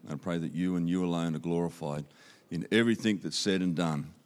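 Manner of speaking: usual speaking voice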